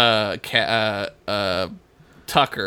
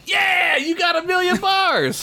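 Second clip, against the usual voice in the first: high excited shout. Real, not acted. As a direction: cheer the right answer. Excited answer to trivia.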